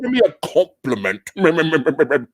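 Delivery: mock British accent with a heavy lisp; lisp British style. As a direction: drag it out in a botched lisping British accent. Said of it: "my lisp British style".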